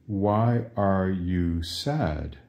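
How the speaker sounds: slow, each word laid out; slow teaching prompt. Shown as a demonstration. Teacher slowly prompting students to translate. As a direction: spell it out slowly for the class. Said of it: "How do you say".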